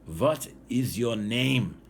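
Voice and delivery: put-on halting Russian-accented English, slow and deliberate; his best English. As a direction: spell out each word like a Russian chief with little English. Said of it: "in his best English".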